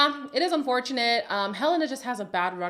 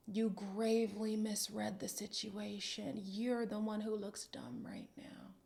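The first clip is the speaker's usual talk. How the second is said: quiet; 12 dB quieter than usual. Real, not acted. Cutting aside to Jesse.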